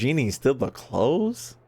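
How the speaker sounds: ordinary talk, nothing marked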